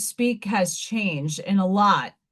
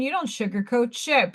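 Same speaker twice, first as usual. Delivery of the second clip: ordinary delivery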